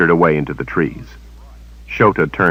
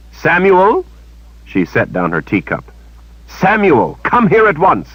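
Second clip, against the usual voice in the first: higher sharp summons across the trees; calling out; 12 semitones higher, 3 dB louder than usual. A narrator's reading. Witch summoning her servant from the trees, sharp call.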